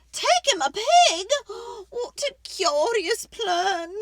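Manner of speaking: put-on high, loud complaining old lady; fretful old lady. Old lady begging the wise man for help, high and pleading.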